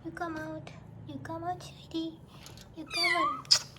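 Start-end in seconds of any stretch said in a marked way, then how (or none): 2.8-3.6 high coaxing call. Real, not acted. Coaxing kitten, sudden high call.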